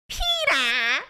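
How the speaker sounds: lightly pinched nasal call; Lois calling Peter. Pretend. Calling Peter, in a pinched Lois voice. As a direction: call out like a cartoon mom. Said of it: "Do I sound like Lois".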